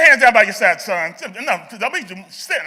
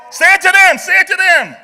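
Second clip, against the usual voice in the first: loud, high coaching call; urging shout; 6 semitones higher, 4 dB louder than usual. Real, not acted. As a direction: push them to shout it across. Urging children on during chant drill.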